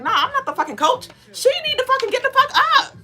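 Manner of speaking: mock indignant outburst. To herself at the soccer game, a mock indignant outburst. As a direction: pitch shooting high, sped-up and brash on the last line. Retelling her prideful inner refusal.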